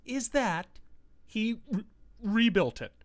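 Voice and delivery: soft, halting, with gaps between phrases; hushed mock disbelief. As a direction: drop right down as if bracing for bad news. Mock dread before a film absurdity.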